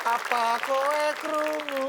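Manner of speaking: slow, high spooky whisper-like call; drawn out ghost voice; eerie ghostly voice. Acted.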